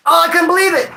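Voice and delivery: high, loud exaggerated disbelief; hammed-up scandalized shout; mock outrage. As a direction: act out a whole crowd aghast. Imagined crowd reacting in disbelief.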